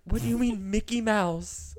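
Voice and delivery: slow, high and incredulous; baffled. Retold or imitated. Girl baffled by the teacher's joke, slow incredulous. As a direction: drag it out in disbelief. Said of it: "I was like".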